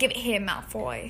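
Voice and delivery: understated put-on British; gentle British accent. Host redoing the only phrase they have, gentle British accent. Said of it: "are a little gentle".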